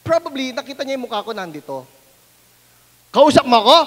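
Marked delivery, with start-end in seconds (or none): 3.1-3.8 shouted loud question; booming old man's shout; loud deaf shout. Retold or imitated. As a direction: yell it like a deaf old man. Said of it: "Sabi niya ganun".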